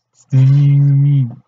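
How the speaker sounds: loud, drawn-out complaint; loud protest. Real, not acted. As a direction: wail it out slowly at full volume. Protesting at friend being mean.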